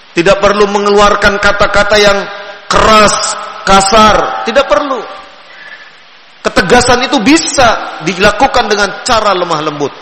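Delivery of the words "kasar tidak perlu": ordinary talk, nothing marked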